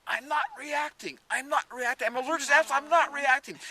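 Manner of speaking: put-on high, loud joyful kid yell. Boy eating apples shouting to everyone, joyful yell. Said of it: "yelling at the top of his lungs".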